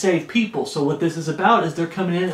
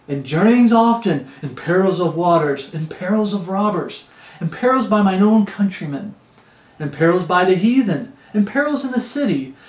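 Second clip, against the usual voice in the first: rhythmic, rising-and-falling list of perils; charismatic preaching. Acted. Preacher imagining Paul listing perils, all charismatic. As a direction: build the repeats like a revival preacher. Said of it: "I can just see him getting, you know, all charismatic".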